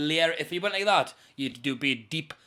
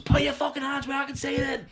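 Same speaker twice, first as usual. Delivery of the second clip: high, loud, threatening order; shouted command; 11 semitones higher, 5 dB louder than usual. Retold or imitated. Hijacker catching the driver moving his hands, shouting.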